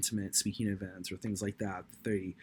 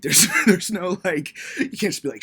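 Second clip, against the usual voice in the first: high and loud, laughing through the words; 7 semitones higher, 12 dB louder than usual. Real, not acted. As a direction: giggle while you tell it.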